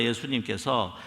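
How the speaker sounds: ordinary talk, nothing marked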